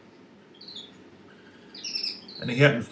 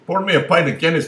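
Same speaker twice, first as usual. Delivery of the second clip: loud order; 9 semitones higher, 10 dB louder than usual. Acted. Man orders at Dublin bar.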